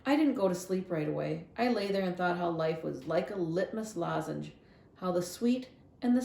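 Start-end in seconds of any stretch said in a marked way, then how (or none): none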